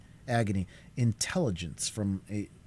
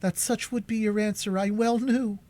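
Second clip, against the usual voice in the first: exclaiming; 13 semitones higher, 3 dB louder than usual. A narrator's reading. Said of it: "exclaimed Cora, her cheeks flushing".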